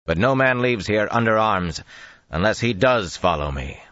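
booming voice. Acted. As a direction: carry the words to hidden men. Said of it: "so they boomed against the wall".

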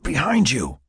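high, pressed warning; urgent warning. A narrator's reading. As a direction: warn her urgently, pitch jumping up. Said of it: "Mac whispered even louder".

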